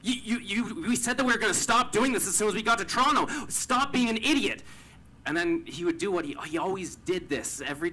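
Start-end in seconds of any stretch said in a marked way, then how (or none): 0.0-4.6 high, loud, exasperated tirade; yelling at a friend. Retold or imitated. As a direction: lash out at him, voice climbing high. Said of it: "I started yelling at him".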